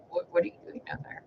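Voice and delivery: hushed whisper to the dog; a soft, voiceless question to a dog under the chair. Retold or imitated. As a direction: breathe it at the puppy without voice. Quietly questioning the hiding puppy.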